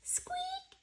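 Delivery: tiny high whispered squeak. Acted. Reading to kids, a tiny high squeak. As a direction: squeak like a little mouse. Mouse squeak sound effect.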